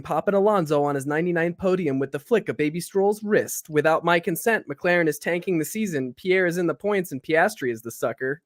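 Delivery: rushed, breathless one-breath race recap; all in one breath. Acted. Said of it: "he's gonna attempt to do it in a single breath".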